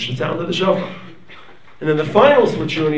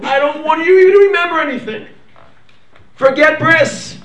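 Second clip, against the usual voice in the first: pleading with God; 10 semitones higher, 7 dB louder than usual. Acted. The people begging God to forget the covenant, passionately pleading. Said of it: "we tell HaKadosh Baruch Hu".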